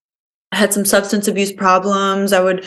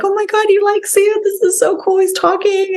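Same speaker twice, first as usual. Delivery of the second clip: much higher, giddy imitation of delighted parents; thrilled parents; 12 semitones higher than usual. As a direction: mimic the parents squealing with joy. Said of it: "his parents were like".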